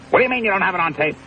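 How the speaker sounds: high, fast put-on voice of a doubting boss; high mocking impression of a doubting boss; mock Shorty disbelief. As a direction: squeak out the boss's disbelief. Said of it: "would probably not believe the person".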